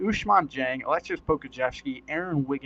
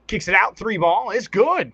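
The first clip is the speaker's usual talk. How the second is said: rising, louder play-by-play burst as the three drops; excited call; 5 semitones higher, 8 dB louder than usual. Real, not acted. Commentator calling a teammate's three, higher and louder. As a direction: sell the made three with a loud, climbing call.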